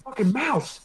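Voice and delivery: high laughing exclamation; excited discovery. Retold or imitated. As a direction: shout out the surprise find. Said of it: "he was like".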